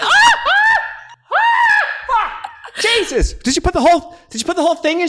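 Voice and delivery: high panicked yelps then shouted question; screaming. Retold or imitated. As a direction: scream in pain, very high and loud. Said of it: "I start screaming".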